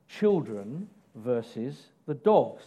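slow, deliberate point title; slow announced heading. Shown as a demonstration. Preacher announcing the third point, slow and deliberate. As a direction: spell the point out slowly. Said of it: "my next point".

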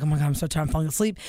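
low, drowsy mumble; imitating a worn-out husband; sleepy husband. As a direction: sag into exhausted sleepiness. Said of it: "He's like".

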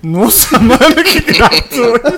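loud laughing high reaction. Real, not acted. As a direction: talk while laughing at the joke. Reacting to a silly joke.

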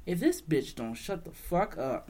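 ordinary talk, nothing marked

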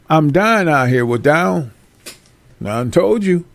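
usual speaking voice

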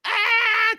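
loud, high mock crying wail; imitated child's wail. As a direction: mimic a kid screaming mid-fit. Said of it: "the kid's, like, crying".

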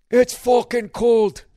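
loud, high, drawn-out mock Scottish; bad Scottish accent. Shown as a demonstration. Scotsman complaining about the weather, hammed-up Scottish accent. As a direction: stretch it out in a bad Scottish brogue. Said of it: "I wish I could do a Scottish accent".

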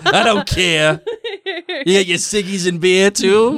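mock Rhode Island Ron, laughing; Rhode Island accent. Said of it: "suddenly Ron's from Rhode Island".

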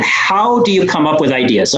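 ordinary delivery

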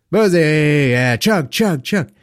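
loud, cheering frat chant; rowdy party animal. Pretend. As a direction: egg on a chugging buddy. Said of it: "frat boy's nickname".

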